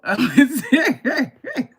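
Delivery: loud, high cackle; high shrieking laugh at your own story; screaming laughter. Real, not acted. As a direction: burst out laughing hard. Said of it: "I be screaming when I say it".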